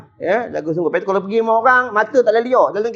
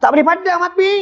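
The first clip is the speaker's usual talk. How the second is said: pleading prophet; 8 semitones higher than usual. Retold or imitated. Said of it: "Kata Nabi Ibrahim".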